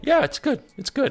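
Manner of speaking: high-pitched wife voice; mimicked wife's perfunctory approval; imitating unimpressed wife. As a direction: give a high, brief brush-off as the wife. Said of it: "she'll be like".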